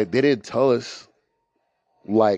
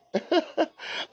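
high breathy laugh; 6 dB quieter than usual. Real, not acted. Laughing at own irony.

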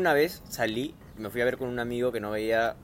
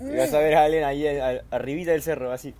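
ordinary talk

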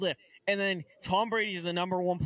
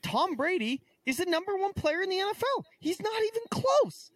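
exasperated outburst; 12 semitones higher than usual. Real, not acted. Outraged rant about Brady ranking.

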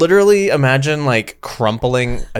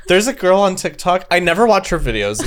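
normal talking voice

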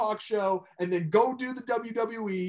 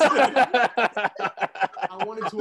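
slow words through a laugh; 3 semitones higher, 6 dB louder, much slower than usual. Real, not acted. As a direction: talk while laughing slowly. Laughing after friends' laughter.

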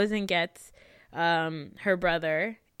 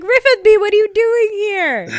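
high, loud mock gasp of recognition; spotting a familiar face somewhere absurd, high and loud; shocked surprise; 14 semitones higher, 14 dB louder than usual. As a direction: blurt it out in loud disbelief, pitched way up. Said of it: "I was like".

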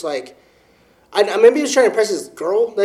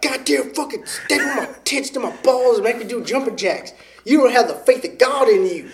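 higher, hammed-up revival preacher; mock preacher; 6 semitones higher than usual. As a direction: rant like a backwoods snake handler. Mock snake-handling preacher.

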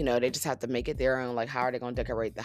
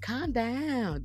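imitating calm down; 8 semitones higher, 5 dB quieter than usual. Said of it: "she was like".

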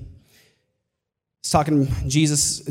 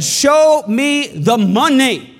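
demanding shout; 8 semitones higher, 5 dB louder, much slower than usual. Acted. Preacher voices Jesus demanding returns.

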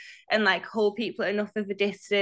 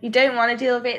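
ordinary talk

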